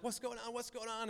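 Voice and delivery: high, softly voiced eager question; retold curious asking. Two blind people asking what's going on, lightly acted curiosity. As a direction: lightly act out puzzled bystanders.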